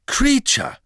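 high, loud startled cry; sudden high shriek at a house-elf appearing. A narrator's reading. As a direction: yelp the name in fright. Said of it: "Hermine stieß einen spitzen Schrei aus".

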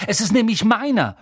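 high, loud indignant claim; angry. Acted. Boy claiming his own letter, loud and indignant. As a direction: insist that it belongs to you. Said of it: "sagte Harry wütend".

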